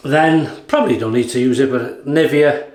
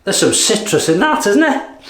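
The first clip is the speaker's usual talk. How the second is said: high, delighted question; pleased, rising with joy; 7 semitones higher than usual. Real, not acted. Delighted sniffing.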